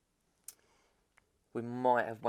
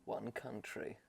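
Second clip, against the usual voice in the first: soft, low trailer voice; hushed dramatic trailer taglines; trailer voiceover; 3 semitones lower, 8 dB quieter, slower than usual. Pretend. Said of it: "a movie trailer voiceover".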